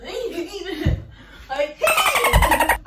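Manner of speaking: very high, drawn-out shriek; mock tantrum scream. Vlogger fed up with baking, mock tantrum scream. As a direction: shriek it as one long squeal.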